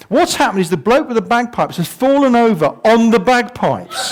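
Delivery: ordinary delivery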